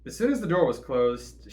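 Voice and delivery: normal talking voice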